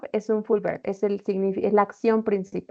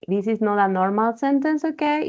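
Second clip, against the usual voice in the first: ordinary delivery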